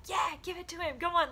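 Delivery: high, loud cheering voice; egging him on, cheering; hammed-up crowd cheering him on; mock cheering. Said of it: "like, cheering me on".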